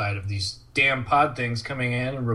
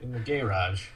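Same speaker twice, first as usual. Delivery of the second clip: softer, low echo of the line; mock eerie echo; 3 semitones lower, 9 dB quieter than usual. Host softly repeats the assistant's eerie line. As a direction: murmur it back, spooked.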